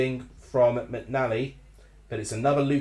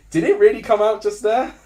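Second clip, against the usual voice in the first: ordinary delivery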